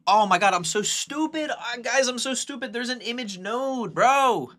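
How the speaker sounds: high-pitched self-scolding squeal; real frustrated squeal at own mistake; exasperated. Real, not acted. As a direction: squeak out your disbelief at yourself.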